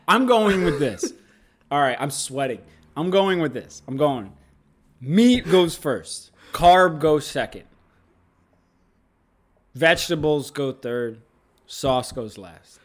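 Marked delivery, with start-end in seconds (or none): 5.0-12.8 slow, deliberate ruling, each line set apart; solemn verdict. Acted. Announcing his final ruling on ingredient order, slow and deliberate. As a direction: hand down the final decree. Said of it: "And there it is. Definitive".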